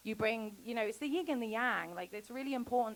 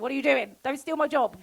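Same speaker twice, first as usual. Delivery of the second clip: lightly voiced husband, a touch louder, teasing; mock husband; 5 semitones higher, 7 dB louder than usual. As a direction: playfully scold her for stealing his job. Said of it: "it's like".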